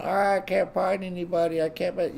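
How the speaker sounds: mock whine, pitched well up; moaning and groaning. Lonely people complaining they can't find anybody, mock moaning. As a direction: imitate a high-pitched bellyacher. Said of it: "people bitch and moan and groan".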